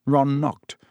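plain reading voice

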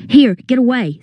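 warding off. A narrator's reading. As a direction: shoo them away with urgency. Fending off snakes.

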